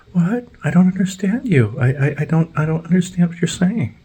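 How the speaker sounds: very high confused voice; squeaky high mock confusion; mock clueless worker. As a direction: play dumb way up high. Mocking dazed leaf blower guy.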